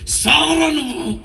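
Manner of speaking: high, slow shouted curse; retold father's enraged yell; furious shout. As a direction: hurl it at the child in rage. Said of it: "కోప పళ్ళు కొరుకుతూ".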